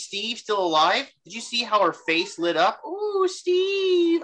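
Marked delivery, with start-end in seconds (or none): 2.9-4.2 high, breathy-free squeal of fake adoration; mocking a lovestruck Peggy; mock swooning Peggy. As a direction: coo his name like a smitten fan. Mocking Peggy's delight at Steve.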